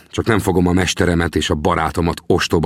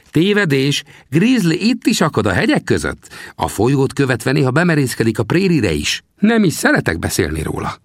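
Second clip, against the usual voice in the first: put-on high, teasing little old man's voice; old trapper Sam; 8 semitones higher than usual. Sam teasingly asks the narrator about his bones, high old voice. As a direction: needle the greenhorn from a high perch. Sam's voice in dialogue.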